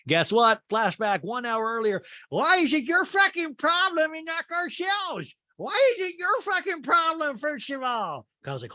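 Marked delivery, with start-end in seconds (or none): 2.3-8.3 high, put-on furious Rinna; mock screaming. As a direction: rant at her, hammed-up. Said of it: "screaming her head off".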